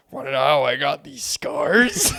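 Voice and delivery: slow, drawn-out menacing villain line; Joker. Acted. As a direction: stretch it out like a creepy villain, slowly. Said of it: "The, the Joker".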